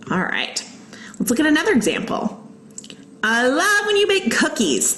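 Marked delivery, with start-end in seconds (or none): 3.2-4.9 higher-pitched exclamation; mildly excited, pitched up; exclaiming. Acted. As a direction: read it as an example exclamation. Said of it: "I exclaimed".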